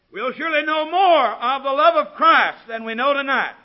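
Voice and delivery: loud, high preacher's proclamation; ringing pulpit declaration at full volume; full-force preaching. Real, not acted.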